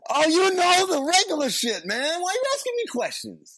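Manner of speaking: high, squeaky defensive voice; mock defensive whine. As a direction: whine it like you're dodging the question. Mocking defensive movie character.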